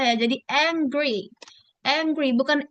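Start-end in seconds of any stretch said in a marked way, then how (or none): 0.5-1.3 "angry" with the G sounded; careful ANG-GRY; G pronounced. Shown as a demonstration. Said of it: "tapi G-nya juga dibaca". 1.9-2.3 "angry" with a hard G sounded; G pronounced. Shown as a demonstration. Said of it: "Jadi G-nya masih dibaca".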